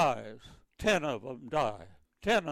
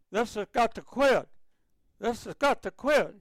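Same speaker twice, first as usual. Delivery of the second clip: higher-pitched, mildly raised voice imitating an angry order; retold yelling; 6 semitones higher, 4 dB louder than usual. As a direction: imitate the captain yelling, without full volume. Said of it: "Captain come running down the stairs yelling".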